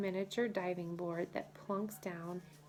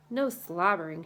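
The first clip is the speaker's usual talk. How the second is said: louder, sharp protest; cries out; 8 dB louder than usual. A narrator's reading. Annie protesting Moose's hug, loud cry. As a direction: push him off with the words. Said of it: "she cries".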